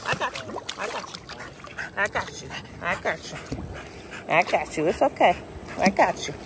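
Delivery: soft, soothing I got you; calm the frightened dog; reassuring. Real, not acted.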